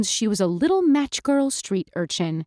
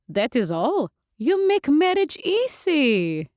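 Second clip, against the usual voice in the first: vampire Lulu amused; 7 semitones higher, slower than usual. Acted. Lulu laughing at condition.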